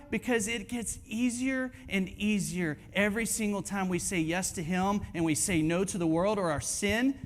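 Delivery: ordinary talk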